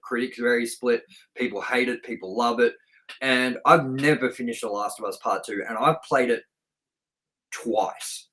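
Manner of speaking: ordinary talk, nothing marked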